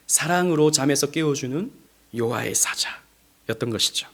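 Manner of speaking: ordinary talk, nothing marked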